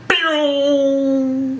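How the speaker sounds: high, sharp sound effect "Pew!"; lightsaber pew. Retold or imitated. Narrating the kill with a high lightsaber zap. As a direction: zap it out like a laser.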